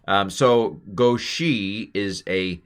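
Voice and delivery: usual speaking voice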